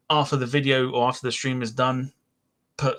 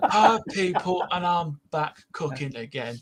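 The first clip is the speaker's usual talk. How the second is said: higher, laughing cooking-show host voice; Case Cooking impression; 6 semitones higher, slower than usual. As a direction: mimic a chirpy cooking presenter through laughter. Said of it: "You remind me of Case Cooking".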